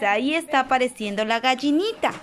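usual speaking voice

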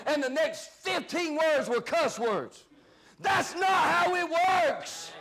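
emphatic, slowing pulpit delivery; fired-up preaching. Real, not acted. Preacher objects to the fighter's cussing, fired-up preaching. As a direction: build to a drawn-out, indignant cry.

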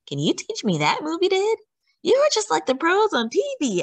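put-on high, excited kid's voice; admiring teammate. A narrator's reading. Admiring teammate praises the goalie.

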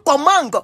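"Comang" with a g wrongly sounded at the end; high-pitched mimicked "Comang", closing on a hard g; Cameroonian pronunciation. Host showing Cameroonians' error in comment, final g sounded in "Comang". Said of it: "il n'y a pas G à la fin".

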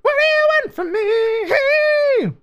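high, slow, drawn-out wail; mock anguished wail. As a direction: stretch it out like a mock lament. Drawn-out mock plea repeated.

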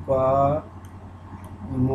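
পা, a long pause, then ম; পা and ম pronounced separately, no L between; sounded out in parts. Shown as a demonstration. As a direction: break it apart as "paa ... mo". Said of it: "পামের উচ্চারণটা হবে এরকম".

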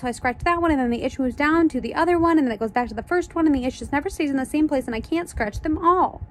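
high, breathless-sounding run-on whine; running high-pitched grumble; whiny complaint. Acted. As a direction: keep complaining in a high, pestered voice. Dana complains about endless itching.

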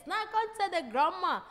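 normal talking voice

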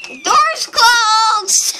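slow, loud drawn-out shout. Pretend. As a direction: yell it out, stretched. Repeated, then shouted.

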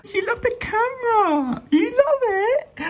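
very high, slow coo. Real, not acted. Cooing at her fish about the camera.

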